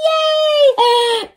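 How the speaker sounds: high happy shout; excited cheer. Acted. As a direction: cheer with joy. Super happy.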